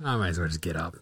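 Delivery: low, sinking, defeated grumble; soft, low, worn-out sigh of words; weary resignation. Retold or imitated. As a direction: give up on sleep, muttering to yourself. Retold giving up on sleep.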